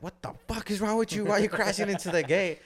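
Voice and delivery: high, loud angry yell; mom yelling. Retold or imitated. Mom yells at son who crashed gate, high angry shout. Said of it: "my mom was like".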